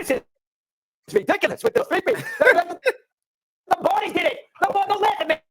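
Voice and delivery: cracking, incredulous protest, slow on the first words; exasperated outburst. Real, not acted. Arguing the Packers three-peat against the host, voice cracking high. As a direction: pitch shoots up high, half laughing in disbelief.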